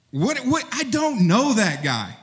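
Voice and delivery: high, insistent I do not know him; panicked denial. Acted. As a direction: push the denial out fast and high. Peter's second denial.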